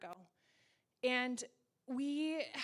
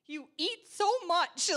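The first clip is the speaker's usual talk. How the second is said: loud, slow, drawn-out complaint; mock exasperation; 4 semitones higher, 9 dB louder, slower than usual. Wife to husband home all day, mock exasperation. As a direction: stretch it out in comic dismay.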